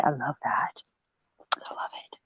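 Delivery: whispered fondness; soft whisper. Real, not acted. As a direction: breathe it softly to yourself. Savoring memory softly.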